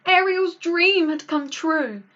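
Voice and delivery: plain reading voice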